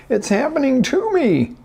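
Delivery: high, whining self-pity; victim whine. Retold or imitated. Coach voices the victim mindset, high and whining. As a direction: wail it like a victim. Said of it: "that victim mindset of".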